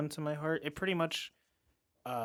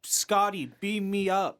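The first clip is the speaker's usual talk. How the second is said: higher, louder captain's command; Captain Kirk order; 5 semitones higher, 3 dB louder than usual. Retold or imitated. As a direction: call it up like a starship captain. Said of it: "Chris Pine said".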